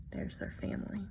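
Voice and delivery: soft unvoiced whisper; hushed aside. Real, not acted. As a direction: breathe it like a secret. Whispered aside about the picture.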